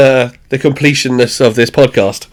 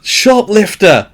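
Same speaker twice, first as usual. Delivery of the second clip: shouting; 7 semitones higher, much slower than usual. Retold or imitated. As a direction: yell the warning across the shop so he hears it. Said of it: "actually shouts".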